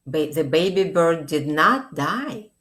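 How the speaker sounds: ordinary delivery, nothing marked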